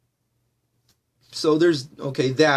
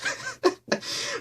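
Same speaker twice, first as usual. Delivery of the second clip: breathy laugh; 8 dB quieter than usual. Real, not acted. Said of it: "Okay, but whatever".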